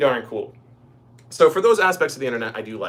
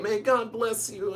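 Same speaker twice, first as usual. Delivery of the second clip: high, slow blessing from an imitated old professor; imitating a devout, kindly old man; professor's voice; 9 semitones higher, 4 dB quieter, slower than usual. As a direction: draw it out sweetly in a put-on high voice. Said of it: "I use this voice, this is literally how he sounds".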